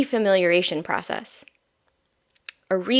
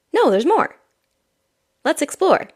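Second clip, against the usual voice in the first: put-on much higher, louder kid-goat voice; eager young goat; 9 semitones higher, 4 dB louder than usual. Young goat answering the bored adult, much higher eager voice. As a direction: burst in, insisting there's more. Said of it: "the young goat that's like".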